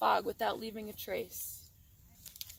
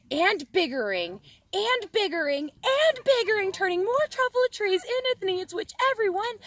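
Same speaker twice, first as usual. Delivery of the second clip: rising, very high, loud rant; furious yell; 10 semitones higher, 8 dB louder than usual. A narrator's reading. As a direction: build to a frenzied shout. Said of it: "I got terribly mad".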